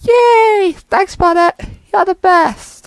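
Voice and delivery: loud, high, delighted cheer. Acted. Thrilled, thanking Bonnet at full volume. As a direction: burst out with joy. Cheering after permission given.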